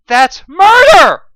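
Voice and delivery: high, loud, slow outraged scream; furious scream. A narrator's reading. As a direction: scream the accusation at the captain. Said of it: "John screamed".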